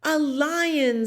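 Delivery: a high, lightly voiced crowd scream; mock scream. A narrator's reading. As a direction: cry out in alarm. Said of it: "screamed the people".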